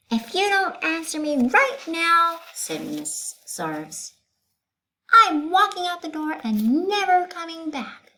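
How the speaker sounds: high, loud, huffy teacher voice; a frazzled teacher demanding respect; indignant teacher. Acted. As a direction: schoolteacher scolding children, high and indignant. Miss Zarves threatens principal.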